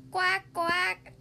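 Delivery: two high quacks. Acted. Worm answers Mister Silly, duck quacking. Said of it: "said the worm".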